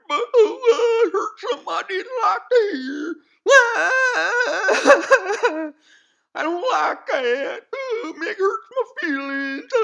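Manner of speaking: high, loud, hammed-up fake sobbing; mock crying. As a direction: wail like an offended crybaby, all put on. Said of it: "then you're gonna cry".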